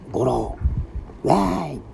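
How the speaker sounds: teasing, high jeering whoop; a schoolboy sticks his tongue out and taunts; mocking taunt. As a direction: jeer at the teachers like a cheeky boy. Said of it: "赤んべをして".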